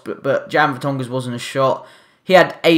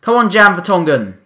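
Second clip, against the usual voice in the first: ordinary delivery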